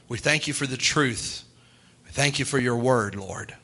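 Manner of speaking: closing prayer. Real, not acted. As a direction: pray them out gently over the music. Closing prayer over music.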